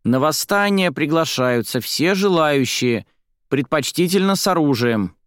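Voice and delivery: high, deliberate newspaper announcement; high-pitched, measured child's proclamation; child reading aloud. A narrator's reading. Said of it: "читала из газеты Марца".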